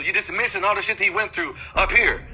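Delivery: normal talking voice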